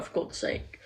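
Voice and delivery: breathy under-the-breath for god's sake; a whispered sigh of annoyance; exasperated whisper. Retold or imitated. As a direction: whisper it to yourself, fed up. Exasperated inner reaction quoted.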